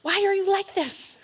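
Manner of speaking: high-pitched questioning of oneself; questioning self-talk. Acted. Speaker talking to own mind and heart, questioning. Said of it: "I talk to my mind and heart and soul".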